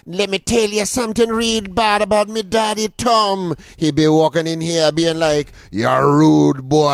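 loud, high hammed-up Jamaican patois; mock Jamaican accent. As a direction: impersonate someone faking a Jamaican accent. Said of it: "starts talking in a Jamaican accent".

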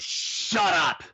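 a flat, blunt "Shut up" thrown at her. Retold or imitated. Restaurant owner telling the complaining lady to shut up, blunt. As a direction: cut her off cold.

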